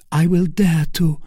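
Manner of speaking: high, pleading woman's voice; pleading Milady. A narrator's reading. Milady begging Felton to let her die, high and pleading.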